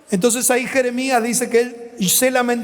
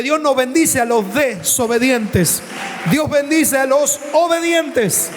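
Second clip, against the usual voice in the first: high, slow, ringing proclamation; emphatic preaching; 3 semitones higher, slower than usual. Real, not acted. Preacher rousing congregation about obedience, high emphatic proclamation. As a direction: raise the pitch and stretch out the climax.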